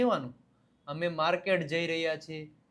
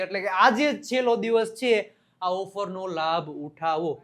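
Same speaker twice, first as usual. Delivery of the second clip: louder urgent sales push; ad pitch; 5 dB louder than usual. Acted. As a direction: urge them to buy today. Last-day offer push.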